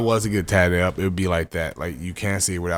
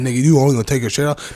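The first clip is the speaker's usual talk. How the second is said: higher, louder taunting dad; mocking father; 6 semitones higher, 7 dB louder than usual. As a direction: taunt him like a rowdy dad.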